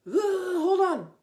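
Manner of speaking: slow, loud, high exasperated hold on; mock weary husband; husband saying hold on. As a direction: groan it like a tired husband. Husband voiced in a story.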